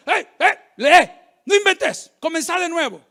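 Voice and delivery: mock scolding shout, slowing on no inventes; stern scolding. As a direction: bark the correction like an impatient teacher. Stern pastor scolding nervous boy reader.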